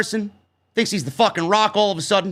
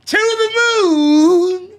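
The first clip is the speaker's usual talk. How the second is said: catchphrase yell; 9 semitones higher, 5 dB louder, much slower than usual. Retold or imitated. Mocking Grimes' catchphrase.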